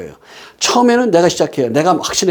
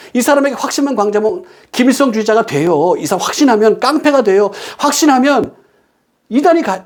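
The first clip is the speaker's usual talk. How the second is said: high-pitched, insistent preacher voice; emphatic preaching; 8 semitones higher than usual. Real, not acted. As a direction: drive the warning home from the pulpit. Preaches with raised intensity.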